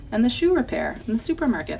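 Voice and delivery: plain reading voice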